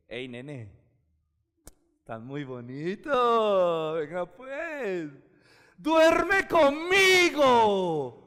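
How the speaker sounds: slow, drawn-out seductive voice; seductive wife. Acted. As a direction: lure him in slowly. Potiphar's wife seducing Joseph. (la esposa de Potifar seduciendo a José, lenta y seductora)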